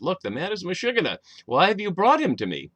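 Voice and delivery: the annoyed king asking who brought this madman; king protesting that the man is meshuggah; exasperated king. Acted. Quoting King Achish, exasperated.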